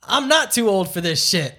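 Danny Glover impression. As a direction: punch out the movie line. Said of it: "he's like".

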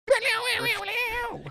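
high, loud warbling rift sound effect; high-pitched sci-fi whoosh by mouth; imitated rift noise. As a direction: make a loud, squealing portal noise.

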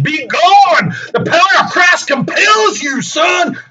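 high, loud hammed-up command; exorcist shout. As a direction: bellow it like a movie exorcist. Angel banishing devils.

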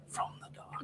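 whispered ominous trailer voice; breathy spooky ad read. Retold or imitated. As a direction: hush it like a spooky audiobook ad. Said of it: "I've gone that ad so many times".

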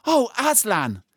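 put-on high, loud, awestruck child's cry; cried out. A narrator's reading. Children see Aslan alive, crying out. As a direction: call the name high in amazement. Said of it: "cried both the children".

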